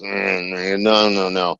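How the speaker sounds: low, grumbling imitation of a dour director; dour refusal. Dour director turning down the idea, low dour refusal. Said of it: "very dour Scot. And he goes".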